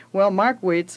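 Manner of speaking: ordinary delivery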